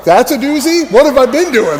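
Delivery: "That's a doozy?" thrown back high and loud in disbelief; incredulous echo. Retold or imitated. Exhausted rider echoing the instructor, stunned disbelief. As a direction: echo "doozy" like you can't believe it.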